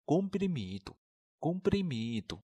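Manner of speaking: comprimido said slowly and clearly, twice; slow model pronunciation. Shown as a demonstration. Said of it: "药片我们同学们都会说吗". (慢速示范读comprimido)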